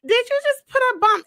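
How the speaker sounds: high, loud disbelieving shout; can't believe he did it; alarmed question. Retold or imitated.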